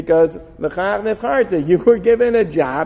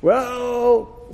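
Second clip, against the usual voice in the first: normal talking voice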